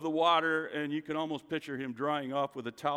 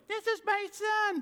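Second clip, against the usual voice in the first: a mock squeaky falsetto, much higher; squeaky voice of God. Preacher joking God might sound high and squeaky, falsetto. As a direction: pipe it up high as a joke version of God. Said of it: "he could have sounded like".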